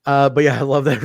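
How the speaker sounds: usual speaking voice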